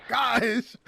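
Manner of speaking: loud exasperated gosh; annoyed gosh. Retold or imitated. Retelling her own annoyance at her friend, loud. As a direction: blurt it out in frustration. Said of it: "I'm just like".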